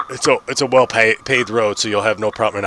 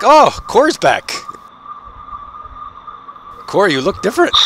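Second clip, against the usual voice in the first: surprised; 10 semitones higher, 3 dB louder than usual. Acted. Character spots someone he takes for Cor, surprised. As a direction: exclaim in high-pitched surprise.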